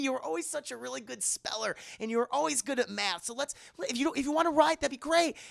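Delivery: high-pitched, encouraging grandmother voice; acted eager fussing over a grandchild; coaxing grandma. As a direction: sweet-talk a child spirit into trying. Grandmother coaxing child spirit to write.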